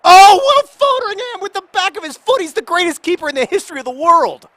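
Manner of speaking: very high, loud commentary cry; ecstatic shout. Real, not acted. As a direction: scream his praise. Celebrating another save.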